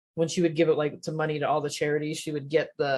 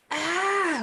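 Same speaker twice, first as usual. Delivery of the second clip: high-pitched mock "ah" of dismay; mock scream; 13 semitones higher than usual. Photographer retelling horror at background people, mock scream. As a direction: let out a high, acted cry. Reacting to weirdos in the wedding shots.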